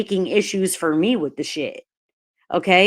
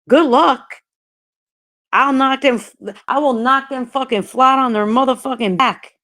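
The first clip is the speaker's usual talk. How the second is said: fierce threat; 4 semitones higher than usual. Real, not acted. Threatens anyone near her dog.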